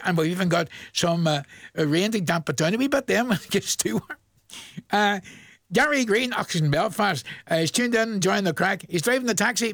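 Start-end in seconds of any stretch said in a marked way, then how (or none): none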